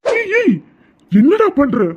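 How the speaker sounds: high frightened shout at his son; panicked cry. Acted. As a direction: yell in shock as he cuts himself. Son starts cutting his hand.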